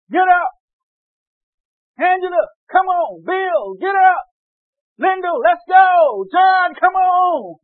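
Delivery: very loud, high, drawn-out calls; summoning shout. Acted. Preacher plays the voice calling the dead by name, full shout. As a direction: bellow each name as if waking the dead. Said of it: "There's gonna be a shout".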